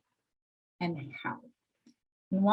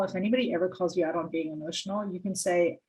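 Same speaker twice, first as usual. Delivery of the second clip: ordinary delivery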